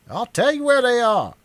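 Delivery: high, loud furious shriek. Acted. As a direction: shriek it at him, beside yourself. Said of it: "man shrieked".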